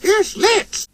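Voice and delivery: highest squeaky eager cry; excited squeal. A narrator's reading. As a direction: squeal yes with delight. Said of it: "said Percy excitedly".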